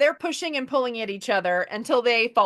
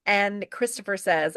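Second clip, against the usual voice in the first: ordinary talk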